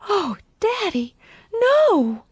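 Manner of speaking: put-on very high, distressed little-girl voice; tearful child's protest. A narrator's reading. Daughter shocked at her father's question, tearful protest. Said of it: "tears swimming in her eyes".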